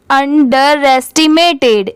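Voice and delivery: अंडरएस्टिमेटेड fairly slowly, अंडर then रेस्टिमेटेड; measured अंडरएस्टिमेटेड with a slight break after अंडर; parts partly joined. Shown as a demonstration. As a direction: join the syllables of अंडरएस्टिमेटेड carefully. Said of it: "और भी part में divide कर दिया".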